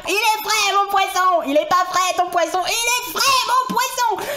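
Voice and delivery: high, loud market hawker calling out; fishmonger cry. Acted. Streamer mock-shouting as a fishmonger selling fish. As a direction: bellow like a fishmonger at his stall.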